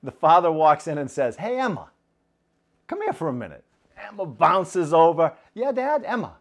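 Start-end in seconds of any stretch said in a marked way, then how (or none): 1.4-3.6 father gently calls his daughter to him; gentle father. Acted. 5.5-6.4 put-on high, chirpy child voice; little girl answers her father, high and chirpy; bouncy little girl.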